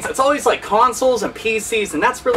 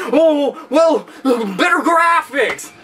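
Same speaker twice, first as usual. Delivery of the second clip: slow, drawn-out smug voice; mocking snob; 3 semitones higher, much slower than usual. Mocking a fanboy who brags about better graphics. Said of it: "I'm just playing games".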